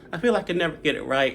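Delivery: ordinary talk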